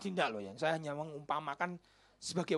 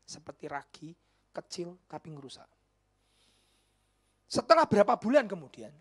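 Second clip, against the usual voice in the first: ordinary delivery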